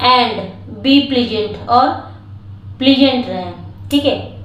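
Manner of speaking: ordinary delivery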